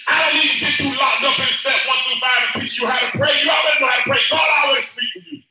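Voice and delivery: rising to a shouted sermon peak; full-force preaching. Acted. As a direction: preach it out over the music, building.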